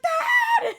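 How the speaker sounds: very high screamed call; panicked scream. Retold or imitated. Stuck child screaming for Dad, panicked. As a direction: shriek for Dad in mock panic. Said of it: "freaking out".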